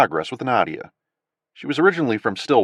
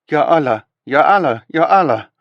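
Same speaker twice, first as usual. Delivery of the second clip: higher, louder panicked repetition; stunned woman crying out at a photograph; shocked repeated cry; 6 semitones higher, 7 dB louder than usual. Acted. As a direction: cry it out as if seeing a ghost. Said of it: "she said over and over".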